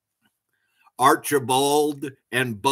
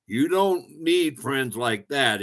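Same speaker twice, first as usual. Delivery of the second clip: plain reading voice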